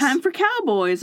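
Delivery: high-pitched playful announcement; mock proclamation. Echoes the mock announcement, higher.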